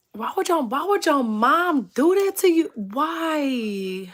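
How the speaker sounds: high-pitched, wailing mock disbelief; mock dismay. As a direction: whine it in playful outrage, drawing out the why. Mock dismay at someone's mom.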